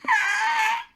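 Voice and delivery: shrieking pterodactyl cry; wyvern screech. Acted. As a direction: screech like a flying reptile. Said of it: "starts doing that".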